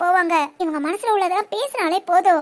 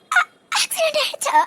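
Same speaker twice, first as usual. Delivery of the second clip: high, tearful denial in broken phrases; crying; 4 semitones higher than usual. Acted. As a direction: sob through it, refusing to believe the news. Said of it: "இப்ப எதுக்கு அழுகுறீங்க?". (அழுதுகொண்டே நம்ப மறுப்பது)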